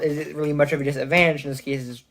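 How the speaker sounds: ordinary delivery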